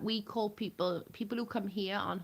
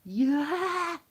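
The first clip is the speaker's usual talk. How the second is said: put-on girl yelling back; 11 semitones higher, 10 dB louder than usual. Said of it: "And she's like".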